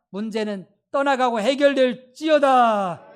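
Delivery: slow, loud rebuking proclamation; commanding shout. Acted. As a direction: cast out sickness and curses with a slow, loud command. Commanding problems to leave. (천천히 크게 외치는 선포)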